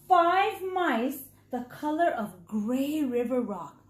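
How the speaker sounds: ordinary delivery, nothing marked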